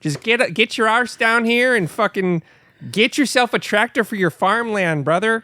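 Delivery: high-pitched hammy local ad voice; Minnesota ad guy. Retold or imitated. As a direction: overdo a small-town tractor commercial. Mock Minnesota tractor ad.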